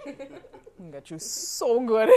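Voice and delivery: playful payback, giggling; laughing threat. Real, not acted. Host jokingly threatens guest while laughing. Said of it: "I'm still trying to recover".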